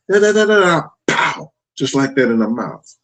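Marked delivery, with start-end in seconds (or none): 0.1-1.5 rapid high babble into a punched "pow"; imitated smack noise. Father re-enacting the smack in her mouth, imitated impact noise. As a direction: cut off the babble with a sudden spoken smack.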